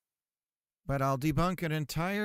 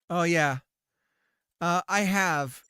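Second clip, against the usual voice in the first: ordinary talk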